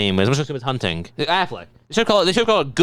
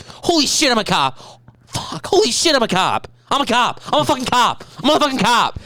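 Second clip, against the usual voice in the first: excited movie quote; 8 semitones higher than usual. Acted. Quoting Let's Be Cops scene.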